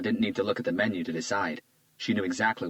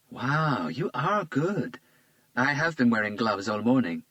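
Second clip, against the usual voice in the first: put-on lightly raised, charmed young woman; a flattered young woman, a bit higher; Isabella's voice; 5 semitones higher, slower than usual. A narrator's reading. Isabella charmed by Hunter's compliment, lightly higher voice.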